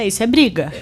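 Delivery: ordinary talk, nothing marked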